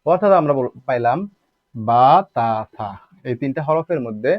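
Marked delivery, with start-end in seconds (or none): 1.7-2.9 slow, loud বা তা সা, each vowel held; one-alif elongation. Shown as a demonstration. Teacher sums up three letters for learners, each drawn out: বা তা সা. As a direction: stretch each aa in বা তা সা. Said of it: "উচ্চারণ করতে হবে এক আলিফ পরিমাণ টানিয়া".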